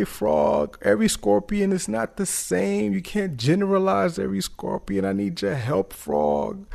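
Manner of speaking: high, pitiful pleading voice; whimpering scorpion. Acted. Scorpion begs the doubtful frog for help, high whimpering plea. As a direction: plead high, as a mock-helpless creature. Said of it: "the scorpion whimpering and, and shaking and shivering and saying".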